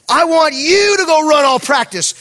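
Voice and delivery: high-pitched, forceful order; commanding coach. Retold or imitated. Head coach ordering the youth pastor to run, angry command. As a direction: order him off as the imitated coach.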